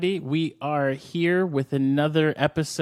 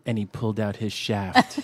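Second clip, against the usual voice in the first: low, hammy seductive reader; mock sexy voice; 5 semitones lower than usual. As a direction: play the sultry erotica reader. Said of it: "sexy voice".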